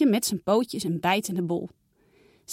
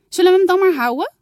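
put-on higher, louder, fast eager little girl; hopeful girl; 10 semitones higher, 9 dB louder, faster than usual. Saar asking mama to keep the kitten, fast eager girl voice. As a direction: rush the hopeful question.

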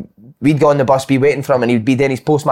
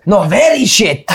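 imitating Di Canio; 7 semitones higher, slower than usual. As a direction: mimic an Italian manager with broken English. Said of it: "And he went".

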